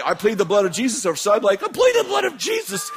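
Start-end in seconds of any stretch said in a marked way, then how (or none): 1.6-2.9 very high frantic shriek; desperate high-pitched comic cry; panicked plea. Retold or imitated. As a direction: squeal it out in mock terror. His own panicked version of it.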